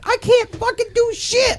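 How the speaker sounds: high-pitched imitation of exasperation; mock a friend's losing meltdown; frustrated rant. Gamer retelling his friend's blowout loss, frustrated rant.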